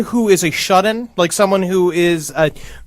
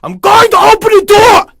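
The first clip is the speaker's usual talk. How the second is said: high-pitched, full-blast shouting mother; sudden shout; 14 semitones higher, 7 dB louder than usual. Acted. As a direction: explode into a much higher, louder yell. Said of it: "the mother suddenly shouted".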